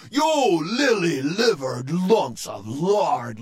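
loud, drawn-out pirate scolding; lashing out. Acted. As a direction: berate the cowardly crew. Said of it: "lashed out Dirty Joe".

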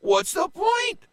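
very high, whiny complaint; whining pirate. A narrator's reading. Pirate whining to Joe, very high. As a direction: moan that it's pointless. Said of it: "another said".

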